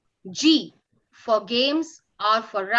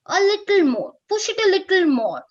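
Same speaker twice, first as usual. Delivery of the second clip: coaxing push; 5 semitones higher, 5 dB louder than usual. Acted.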